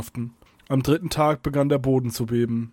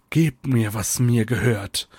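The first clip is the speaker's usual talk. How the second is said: slowing, cold villain's demand; icy hiss; 3 semitones lower, slower than usual. A narrator's reading. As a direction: demand it icily as the dark mage. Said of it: "zischte er eisig".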